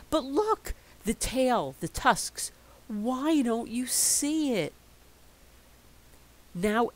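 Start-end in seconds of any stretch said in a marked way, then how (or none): 0.1-4.7 put-on pleading child.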